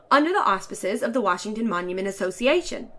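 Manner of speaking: ordinary delivery, nothing marked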